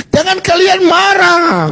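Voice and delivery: high, slow, drawn-out taunt; sing-song jeer at angry critics; mocking taunt. Real, not acted. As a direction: stretch it out high to needle them. Taunting opponents in heated debate.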